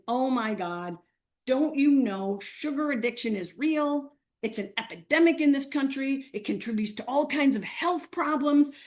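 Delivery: hammed-up outrage at being poisoned; mock outraged rant. Student ranting at teacher about the candy, loud and outraged.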